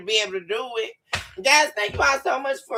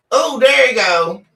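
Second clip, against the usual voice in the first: ordinary talk, nothing marked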